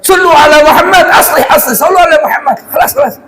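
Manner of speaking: high, loud call to calm the brawl; retold shout. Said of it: "Bapak teriak dah". (teriakan melerai keributan, jauh lebih tinggi)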